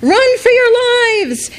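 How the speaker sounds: very high, drawn-out terrified cry; panicked scream. Acted. Terrified townspeople fleeing the monster, screaming. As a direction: scream it as you flee. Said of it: "The people screamed".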